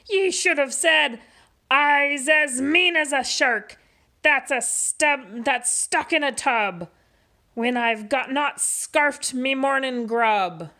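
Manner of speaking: mock shout. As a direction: show him how a pirate brags. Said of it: "Pete cried".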